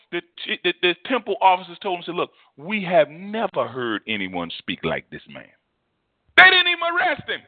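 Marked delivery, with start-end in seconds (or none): 2.1-5.5 low, slow, weighty testimony; awed report. Retold or imitated. Preacher voicing officers reporting on Jesus, hushed awe. 6.4-7.4 high, loud burst of disbelief; amazed exclamation. Real, not acted. Preacher marvelling to congregation at officers' failure, incredulous.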